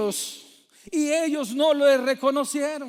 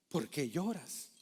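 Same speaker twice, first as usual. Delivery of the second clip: low and much softer; hushed question; 9 semitones lower, 12 dB quieter than usual. Acted. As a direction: ask it quietly, gently. Repeats the angels' gentle question.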